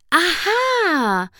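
high, drawn-out cry of discovery; sudden exclamation. A narrator's reading. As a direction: burst out with a triumphant, stretched aha. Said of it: "rief Twilight plötzlich".

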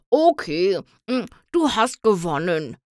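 mumbling through crunchy snacks; mouth full. A narrator's reading. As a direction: talk around a mouthful of treats. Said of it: "nuschelte er".

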